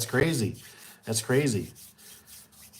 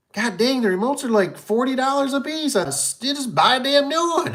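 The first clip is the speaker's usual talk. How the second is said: high, louder fed-up outburst; mock exasperation; 12 semitones higher, 4 dB louder than usual. Installer missing remotes, high exasperated rant. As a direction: blurt it out like a frustrated installer. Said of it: "I went".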